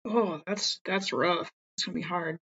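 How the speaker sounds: ordinary talk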